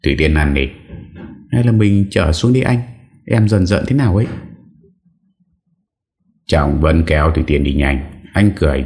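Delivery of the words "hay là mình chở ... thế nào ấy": put-on higher, faster pleading young wife. Wife voiced pleading in story. (giọng vợ trẻ năn nỉ, cao và nhanh)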